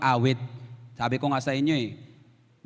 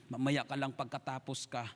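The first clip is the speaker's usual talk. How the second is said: much softer stern father warning under his breath; hushed scolding; 4 semitones lower, 14 dB quieter than usual. Retold or imitated. Father quietly warning his son during the service. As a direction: hush the boy with a threat.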